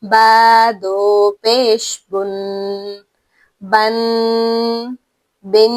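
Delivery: بن repeated slowly with a humming n; nasal ghunna. Shown as a demonstration. Teacher drilling tanween with pesh, slow and nasal. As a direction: let each بن hum. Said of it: "غنہ کے ساتھ".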